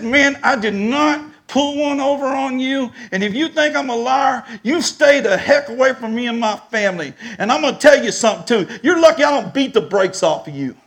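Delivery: high-pitched, heated telling-off; furious warning. Retold or imitated. Accused man warning the buyer away from his family, furious. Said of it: "I was so furious".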